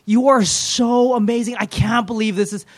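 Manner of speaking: high, gushing cry of worship; acted rapture, stretched and effusive; overjoyed outpouring of praise. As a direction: pour out amazed thanks, pitched right up. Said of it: "the outpouring of praise".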